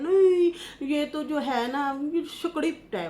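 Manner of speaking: high, scoffing imitation of the sceptics; mocking doubters. Mimicking people who call it a script, scoffing and high. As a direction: sneer it like a know-it-all. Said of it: "वो कहते हैं".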